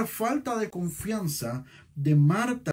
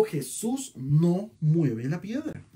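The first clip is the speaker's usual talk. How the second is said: Jesús no mueve la piedra, word by word, very slow; slowed preaching emphasis; slow emphatic; much slower than usual. Real, not acted. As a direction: lean hard on each word.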